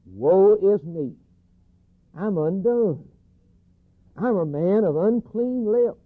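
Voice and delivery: high, loud, drawn-out lament; anguished cry. Retold or imitated. Preacher voicing the prophet before God, anguished cry. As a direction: cry it out slowly as a confession. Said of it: "And he cried".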